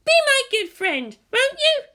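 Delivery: sugary, slightly pushy request; Asuka, sweetly. A narrator's reading. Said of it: "She smiled".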